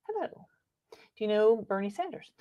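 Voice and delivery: normal talking voice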